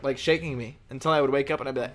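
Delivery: usual speaking voice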